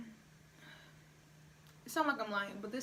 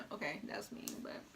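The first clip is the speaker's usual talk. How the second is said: whispered aside; 6 semitones lower, 6 dB quieter than usual. Real, not acted. Laughing at her own remark.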